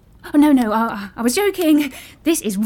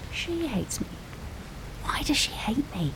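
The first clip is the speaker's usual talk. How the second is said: hurt little whisper; whispered to self; 11 dB quieter than usual. Acted. Alone after the cleaner leaves, hurt whisper to herself. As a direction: whisper it to yourself, wounded.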